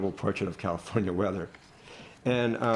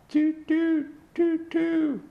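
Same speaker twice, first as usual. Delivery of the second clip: very high, louder hooting toot toot; owl hoots; 3 dB louder, much slower than usual. Acted. Poet voicing the owl in the play, hooting very high. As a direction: hoot like an owl. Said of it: "The owl".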